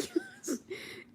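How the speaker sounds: very high giggle; high-pitched giggle; high squeaky laugh. Real, not acted. Laughs at a live comment.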